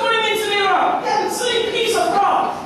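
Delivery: high scornful jeer; high mocking townsman. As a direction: sneer at the useless rock. Mocker voiced in story.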